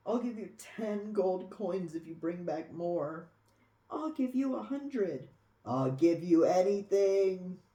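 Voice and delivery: pleading that builds to a loud desperate offer; greedy dragons outbidding each other, ending loud on anything; escalating pleading. Acted.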